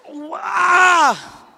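high, loud shout of dismay; angry shout. Retold or imitated. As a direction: yell at the loss. Father reacts to lost GoPro.

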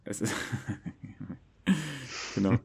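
ordinary delivery

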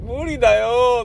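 high petulant no way; whiny refusal. Retold or imitated. Actor refusing the director's request, high and whiny. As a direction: whine that it can't be done. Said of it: "とかって言ってね".

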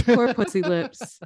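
drawn-out mock pity, rising at the end; mock sympathy. Co-host echoing the nickname in slow mock pity. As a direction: coo it slowly like pitying a pet.

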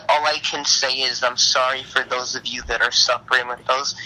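ordinary delivery

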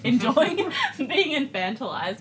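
laughing through the words. Real, not acted. Laughing while telling.

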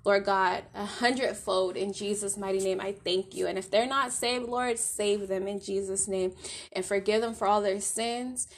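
soft fervent closing prayer. Acted. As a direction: pray over the listeners, fading toward the amen. Prays over the listeners.

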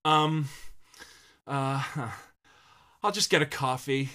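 a mocking, overdone awkward pause; imitating the hero. Mocking the actor's awkward coffee-shop moment. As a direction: send up the hero's weird hesitation. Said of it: "He does this".

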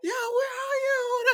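very high, drawn-out calling out; retold yell. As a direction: holler for a lost child, replayed. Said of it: "I yell, I'm like".

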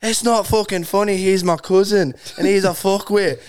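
put-on Irish accent, breaking into a laugh. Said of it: "two Irish chicks".

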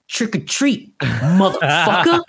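mock gangster taunt, breaking into a laugh; retelling a favourite movie line with a laugh. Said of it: "and he goes".

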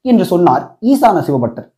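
usual speaking voice

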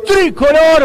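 long, loud stretched goal cry. Real, not acted. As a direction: hold the goal call at full voice. Second goal in radio commentary.

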